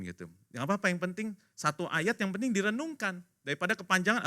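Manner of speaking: ordinary talk, nothing marked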